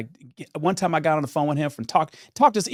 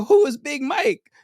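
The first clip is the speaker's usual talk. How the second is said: high, drawn-out incredulous question; acted-out astonishment; baffled amazement; 13 semitones higher, 4 dB louder, slower than usual. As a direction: ask slowly, utterly amazed. Retells baffled follow-up question.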